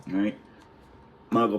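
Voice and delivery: plain reading voice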